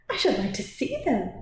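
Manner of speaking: put-on mildly indignant young woman; a touch of proud defiance; dignified cry. A narrator's reading. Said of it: "cried Cynthia with dignity".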